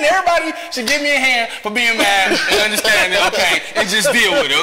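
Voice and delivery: loud, high comic rant; mock self-righteous anger. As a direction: argue loudly for your right to be mad, laughing. Acts out his self-justifying anger.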